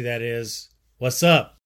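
ordinary delivery

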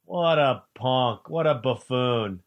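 ordinary delivery

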